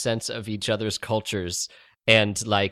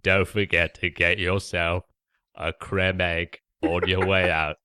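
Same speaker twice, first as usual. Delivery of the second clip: low Cockney actor impression; Michael Caine; 4 semitones lower than usual. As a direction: put on a low, clogged London voice. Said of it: "like if you took Michael".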